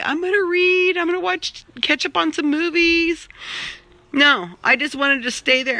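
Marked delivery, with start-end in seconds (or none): 0.0-3.3 lightly mocking eager self, higher; a touch of mock optimism; imitating hopeful self. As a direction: mimic your own big plans. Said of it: "You, you say".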